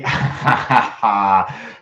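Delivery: laughing. Real, not acted. Laughs at his idea.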